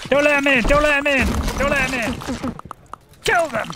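high, loud urgent yelling; shouted battle orders. Real, not acted. Player to troops as enemies break in, urgent shout. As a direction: yell frantic orders at your troops.

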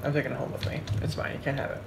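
soft, half under the breath; quiet. Real, not acted. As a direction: murmur it possessively to yourself. Claiming the cookie.

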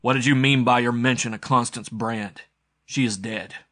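hard, forceful accusation; harsh, rasping. A narrator's reading. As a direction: rasp it out like steel. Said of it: "voice was harsh as the rasp of steel".

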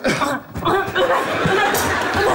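long strained cough; coughing fit. Acted. Boyfriend coughing in front of girlfriend, long coughing fit. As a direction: cough hard and helplessly. Coughing sets off virus panic.

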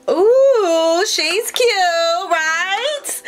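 very high, delighted cooing; gushing squeal. Real, not acted. Showing viewers the bag she picked, gushing. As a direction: coo high and drawn out.

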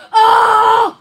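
loud burning reaction; roast shout. Real, not acted. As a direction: yell Oh like a crowd.

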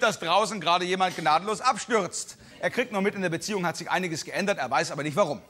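ordinary delivery

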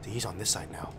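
low, soft whisper; whispering while hiding. Real, not acted. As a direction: hush it so he can't hear. Hiding from Freddy.

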